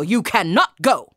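loud soldier's order; commanding guard; firm refusal. Acted.

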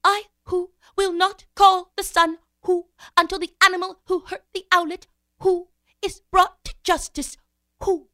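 high hooting owl voice; Mother Owl. A narrator's reading. Mother Owl refuses to call the sun, hooting solemnly. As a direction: hoot between phrases, high and measured. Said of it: "Mother Owl said".

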